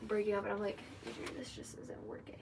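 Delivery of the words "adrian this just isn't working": mock serious breakup, read like a script; half-whispered fake serious breakup; stiff scripted breakup line. Shown as a demonstration. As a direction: deliver it like reading off a script. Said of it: "I'm trying to be serious".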